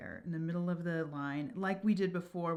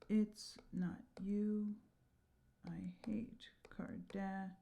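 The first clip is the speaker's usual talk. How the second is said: slow, much softer whisper; whispered confession; 9 dB quieter, slower than usual. Shown as a demonstration. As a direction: confess it under your breath, slowly. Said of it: "extend the word Cardassian".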